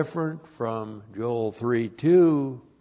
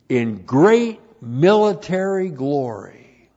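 loud, raised declamatory teaching voice; emphatic point read-out; 5 semitones higher, 8 dB louder than usual. Real, not acted. Preacher dictating a numbered point to the congregation, emphatic read-out. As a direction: announce it forcefully, word by measured word.

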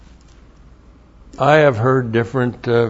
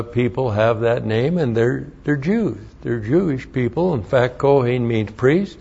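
normal talking voice

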